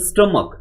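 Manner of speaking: "stomach" ending in a hard k; correct pronunciation. Shown as a demonstration. Teacher showing how to pronounce it, hard-k "stomach". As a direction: say "stomach" as STUM-uk. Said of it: "How to pronounce this one".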